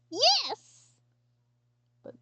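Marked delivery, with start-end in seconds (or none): 0.1-0.7 gleeful yes. Retold or imitated. Her gleeful reply in skit.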